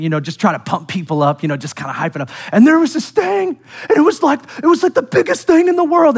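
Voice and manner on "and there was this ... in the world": high, overexcited exaggerating voice; hyped-up storytelling. Pastor mocks himself overhyping a story to the congregation, high excited voice. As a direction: pump the crowd up with a breathless tall tale. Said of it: "if I were to tell a story and I were to start exaggerating".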